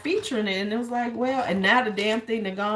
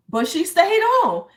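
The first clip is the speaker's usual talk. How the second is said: astonished; 10 semitones higher, 7 dB louder than usual. Real, not acted. Amazed she stayed on call.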